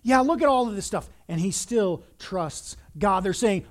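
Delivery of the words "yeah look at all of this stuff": louder, higher jeer; mock him as the crowd would; loud heckler's taunt. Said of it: "they're mocking him and saying".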